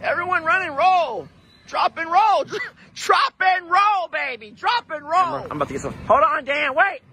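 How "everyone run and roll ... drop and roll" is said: high, urgent mock warning; voicing the hen. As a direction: play the bird telling everyone to flee. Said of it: "she's telling everyone".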